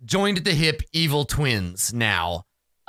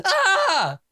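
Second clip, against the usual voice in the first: short high-pitched comic scream; mock Wilhelm scream. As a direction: yelp out a fake movie scream. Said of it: "we hear a Wilhelm".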